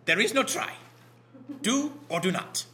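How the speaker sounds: lightly voiced Yoda; Yoda impression. Geeky pastor quoting Star Wars, lightly doing Yoda. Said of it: "I'm doing my Yoda".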